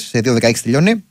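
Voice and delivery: ordinary talk, nothing marked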